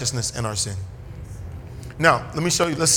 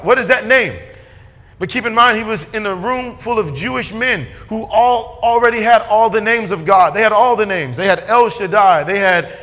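much louder and higher, hammering each name; a pastor at his peak, loud and driving; preaching at full force; 5 semitones higher, 6 dB louder than usual. Acted. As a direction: shout the names of God to the congregation.

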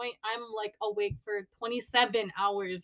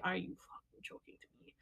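hushed, disbelieving mutter under the breath; incredulous whisper; 3 semitones lower, 17 dB quieter than usual. Retold or imitated. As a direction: whisper it like you can't believe the ask. Angry retort to boss.

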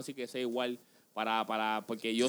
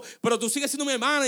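mock blunt friend; 10 semitones higher, 5 dB louder than usual. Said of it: "te van a decir".

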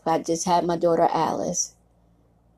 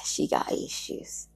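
gossipy whisper; 6 dB quieter, slower than usual. Retold or imitated. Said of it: "Or they could be like".

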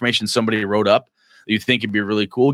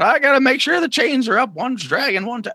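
put-on higher, fussy old man muttering; old tow truck man; 12 semitones higher than usual. Old tow truck man checks his chains, high fussy voice. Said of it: "he just kinda plods around his truck".

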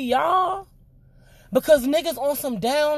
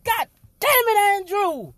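mock Andrew cursing; 4 semitones higher than usual. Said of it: "Why you had to be like".

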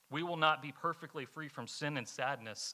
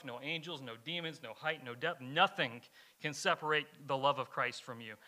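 ordinary talk

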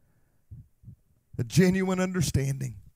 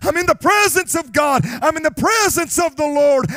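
Isaiah crying out; 8 semitones higher, 6 dB louder than usual. Acted. Isaiah crying out before God, shouting in anguish. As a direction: wail it like a prophet undone. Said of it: "Isaiah cried out and he said".

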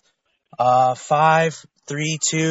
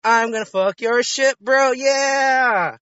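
shouting; 6 semitones higher, slower than usual. Pretend. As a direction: yell it like a hype threat. Hacker boast to scammer.

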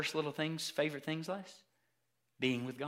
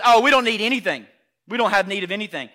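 loud, brisk dismissal; self-sufficient man; 12 dB louder, faster than usual. Acted. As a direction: brush it off like a proud grown-up. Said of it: "The man says".

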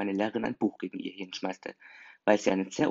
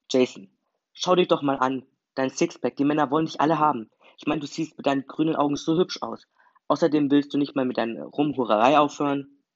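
higher, louder insistent friend; playful scolding; 5 semitones higher, 6 dB louder than usual. A narrator's reading. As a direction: lecture a friend with emphatic energy. Sarah's lively teasing lecture to Jason. (eindringlich und lauter, freundschaftlich schimpfend)

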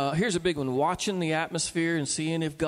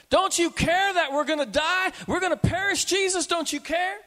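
much higher, shouted mock terror; terrified disciples; 11 semitones higher, 4 dB louder than usual. Disciples cry to sleeping Jesus, terrified shout. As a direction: cry out to Jesus as the boat sinks.

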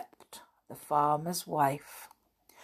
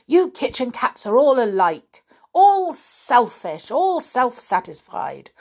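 higher, louder goblin scolding; impatient hobgoblin; 6 semitones higher, 7 dB louder than usual. A narrator's reading. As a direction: sneer at the kitchen cats.

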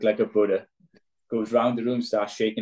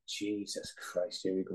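muttered to himself; 12 dB quieter than usual. Retold or imitated. Retold nervous inner thought.

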